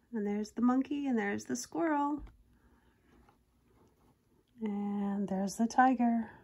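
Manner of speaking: lively storybook reading voice; reading a picture book to kids; story narration. A narrator's reading. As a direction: read it like a bedtime picture book.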